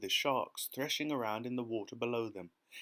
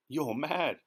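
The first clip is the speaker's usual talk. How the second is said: cried; 6 semitones higher, 4 dB louder than usual. A narrator's reading. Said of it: "cried the earthworm".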